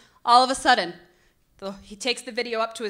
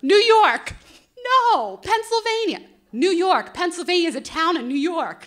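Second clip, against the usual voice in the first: insistent; 6 semitones higher, 6 dB louder than usual. Retold or imitated. Moreno insists Scranton is New York.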